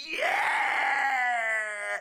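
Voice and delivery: high cheering shout; excited yell. Real, not acted. Reacting to Asuka's catchphrase with an excited cheering yell.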